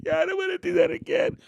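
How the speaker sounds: high, whiny mock sobbing; crying kid. Crying kid answering the parent, mock high wail. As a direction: imitate a kid wailing. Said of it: "Some kids were crying".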